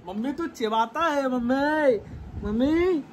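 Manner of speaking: high, drawn-out teasing call; playful teasing. Pretend. Son teasing his annoyed mother, playful drawl. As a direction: stretch the words out in a high, playful singsong.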